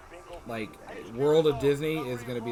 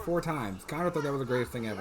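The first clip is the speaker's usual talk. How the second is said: ordinary talk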